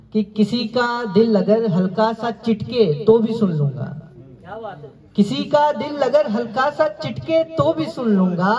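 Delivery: lifted, lilting mushaira verse; poetry recitation. Acted. Recites couplet.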